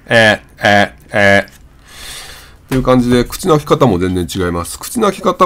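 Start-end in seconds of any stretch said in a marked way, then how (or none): 0.1-1.5 え as a wide American 'æ'; cat vowel demonstration; mouth wide open. Shown as a demonstration. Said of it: "口も結構大きく開ける".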